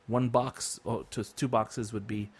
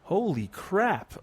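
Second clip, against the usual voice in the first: surprised; 9 semitones higher, 3 dB louder, much slower than usual. Real, not acted. Shocked at cheap internet.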